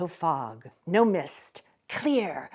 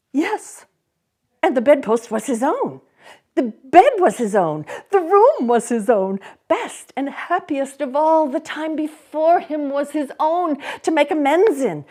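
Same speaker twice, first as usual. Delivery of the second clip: louder, higher, rising joy; joyful; 8 semitones higher, 7 dB louder than usual. A narrator's reading. Narrator rejoicing that the time is his own, joyful. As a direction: let the relief burst through.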